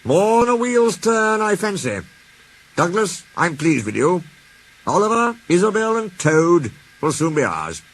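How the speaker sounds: lightly voiced beaming manager; Fat Controller pleased. A narrator's reading.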